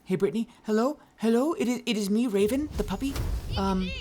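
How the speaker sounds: high, breathless puppy talking to Brittany; a puppy with urgent news. Retold or imitated. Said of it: "I'd be like".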